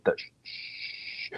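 soft, drawn-out shush; hushing shh. Retold or imitated. Dad looking outward shushes his son, soft shh. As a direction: shush someone very quietly. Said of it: "he gives me the quiet".

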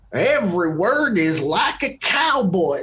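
mock cowboy Southern accent; cartoony Southern accent. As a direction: exaggerate a fake Southern accent on every word. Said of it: "it's not quite that cartoony".

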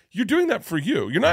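husband parodies wife's scolding, high and loud; mock wife, scolding. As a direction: play the wife telling him off. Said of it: "She's like".

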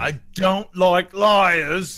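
slow, high, loud ogre voice; big slow-witted ogre, suspicious. Acted. Ogre challenging a stranger at the window, suspicious.

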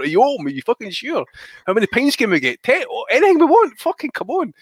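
high, loud mock excitement climbing to a yell; eager pubgoers. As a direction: ham up thirsty drinkers at the pub door. Desperate pubgoers at reopened pub.

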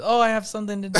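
hammed-up excuse to slip out; mock excuse. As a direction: imitate a guest suddenly needing to leave. Acting guests making excuses to leave.

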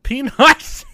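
high, loud, amused disbelief; loud, high-pitched incredulous repeat; incredulous echo. Real, not acted. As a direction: blurt it back as a question.

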